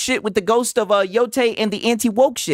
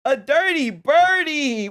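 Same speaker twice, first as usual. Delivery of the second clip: drawn-out teasing; 5 semitones higher, slower than usual. Real, not acted. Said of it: "Sigs is a scumbag and a dirty bird".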